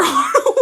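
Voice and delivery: sudden high laugh; quick surprised laugh. Real, not acted. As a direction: burst out laughing. Live stream reaction laugh.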